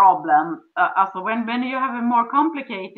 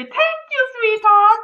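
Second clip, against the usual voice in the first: much higher, sugary thank-you; sweetest smile; 12 semitones higher, 7 dB louder than usual. Shown as a demonstration. Wife thanking a resistant husband with a sweet smile. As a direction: say it with your sweetest smile, high and bright. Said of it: "with your sweetest smile".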